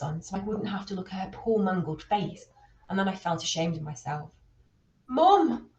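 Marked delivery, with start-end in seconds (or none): 5.1-5.7 high, loud desperate call; child calling for mum in the dark; crying out. A narrator's reading. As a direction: cry out as if she were lost. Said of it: "I cried out like she'd been lost to me".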